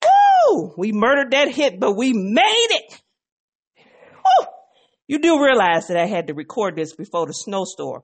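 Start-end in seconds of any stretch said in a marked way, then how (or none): 0.0-0.7 very high, loud woo; excited whoop. Real, not acted. Cheering after finishing song. 3.8-4.5 very high, loud woo; excited whoop. Real, not acted. Cheering after finishing song.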